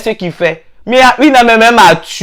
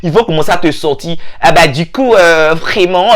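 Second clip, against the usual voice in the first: ordinary talk, nothing marked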